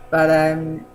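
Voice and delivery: hoarse catch in the throat; frog in throat. Real, not acted. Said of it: "I got a frog in my throat there".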